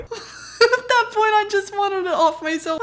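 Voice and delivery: high slow words through real laughter; can't stop laughing at herself. Real, not acted. Recalling the awkward doorstep, she laughs through it.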